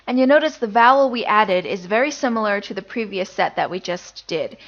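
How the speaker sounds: normal talking voice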